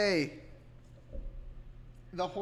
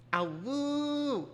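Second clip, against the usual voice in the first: wolf howl; 8 semitones higher, 3 dB louder than usual. Pretend. Howls to chat's wolf.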